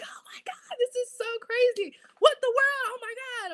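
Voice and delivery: replaying the moment you lost it in private; shrieking excitedly over surprise news; freaking out. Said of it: "I'm, like, freaking out".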